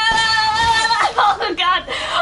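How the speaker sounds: long, high, loud wail of fear; panicked screaming. Real, not acted. Player under attack in horror game, panicked screaming.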